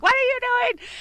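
loud, high alarmed shout. Retold or imitated. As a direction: yell it across the lawn. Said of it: "waving their hands".

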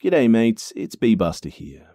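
ordinary delivery, nothing marked

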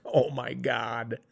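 slow, hushed realisation; stunned dismay. Retold or imitated. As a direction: let it sink in, softly and slowly. Retold stunned reaction to stranger.

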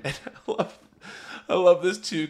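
usual speaking voice

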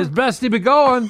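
high, drawn-out farmer voice. Pretend.

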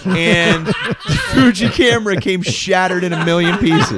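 laughing through the words; amused, laugh breaking in. Real, not acted. Laughing at the shattered camera.

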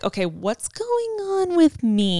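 drawn-out, high-pitched mock self-check; self-questioning. Acts out questioning herself inside.

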